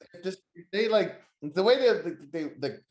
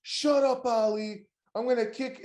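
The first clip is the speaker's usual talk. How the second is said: mock shout; 6 semitones higher, slower than usual. Wrestler cutting off his rival's promo, in a mock higher yell. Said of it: "He's like".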